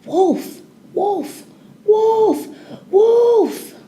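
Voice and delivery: high, loud cry rising with each call; shouting Wolf. A narrator's reading. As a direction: yell it from the treetop to the village. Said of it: "started shouting towards the village".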